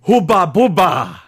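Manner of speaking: loud, slow, drawn-out Hubba Bubba; shouted answer. Real, not acted. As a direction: call it out like a game-show buzzer answer.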